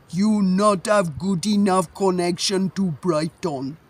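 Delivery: put-on broken English, heavy foreign accent, drawn out. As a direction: mimic a halting, heavily foreign-accented official. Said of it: "was like this ... quite good at mimicking".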